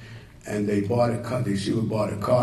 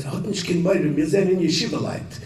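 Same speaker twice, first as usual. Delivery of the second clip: raised, gentle reassurance quoted in Yiddish; calm the frightened girls in Yiddish, pitched up; reassuring in Yiddish; 6 semitones higher than usual. Retold or imitated. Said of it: "I'll say it in Yiddish first".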